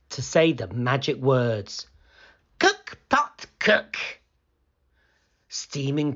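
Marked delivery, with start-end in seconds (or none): 2.6-4.0 put-on high-pitched eager boy's command; excited storybook boy. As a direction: call the spell out high. Boy says magic words.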